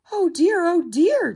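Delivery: high, loud duckling dismay; cheeping duckling. A narrator's reading. Duckling frets at Mouse's warning, cheeping high. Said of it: "cheeped little duckling".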